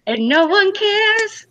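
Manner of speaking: high, drawn-out heroine imitation; slow, high mock-mermaid line; Ariel. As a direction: stretch it out high like the cartoon song. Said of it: "It's like".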